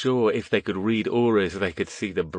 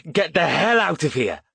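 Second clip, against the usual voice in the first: high, raised angry shout; 8 semitones higher, 4 dB louder than usual. A narrator's reading. Boy shouts at the thugs to leave, high angry shout. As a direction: bark them away.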